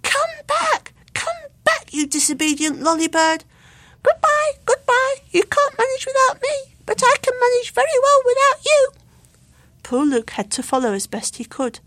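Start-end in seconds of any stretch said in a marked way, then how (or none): 0.0-6.8 very high, loud parroting squeaks; piping echo birds; squeaky echo voices. A narrator's reading. Echoed squeaky bird voices. 6.8-8.9 very high, loud parroting squeak; piping echo bird; squeaky echo voice. A narrator's reading.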